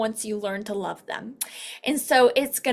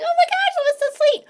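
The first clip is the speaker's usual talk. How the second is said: much higher, louder squeal of delight; delighted gush; 5 dB louder than usual. Pretend. Referral hearing about her free gift, squealing. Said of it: "she's gonna be like".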